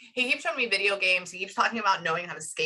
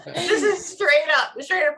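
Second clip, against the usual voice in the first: high, slow, laughing through the words; cracking up at the blunt advice; 9 semitones higher, 6 dB louder, slower than usual. Real, not acted. Medium to couple, laughing at the son's advice.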